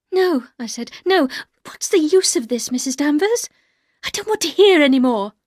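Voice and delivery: wife refusing to listen, high and upset; distressed refusal. Acted. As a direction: push her words away.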